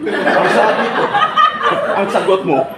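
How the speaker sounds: chuckling mock reprimand; laughing scold. Real, not acted. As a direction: tease him for dodging the question, laughing. Mock-scolding an evasive answer.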